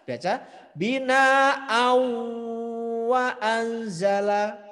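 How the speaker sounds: bina'au wa anzala slowed, the n merged into the w with nasal hum; bina'au wa anzala drawn out, the n blended and nasal. Shown as a demonstration. Teacher demonstrating the correct idgham reading, slow and high. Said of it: "Harus dibaca idgham lebur dan dengung".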